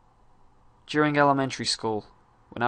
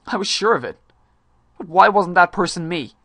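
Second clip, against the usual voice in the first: higher, louder, demanding cry; frustrated outburst; 5 semitones higher, 8 dB louder than usual. Acted. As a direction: burst out in frustration. Outburst in dramatic monologue.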